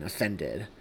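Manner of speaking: plain reading voice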